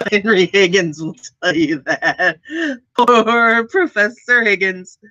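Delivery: talking through laughter; amused laughing. Real, not acted. Joking about Henry Higgins and accents, laughing as she talks. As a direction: let laughter spill into the words.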